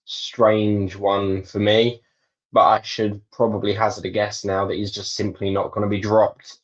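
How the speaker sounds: ordinary delivery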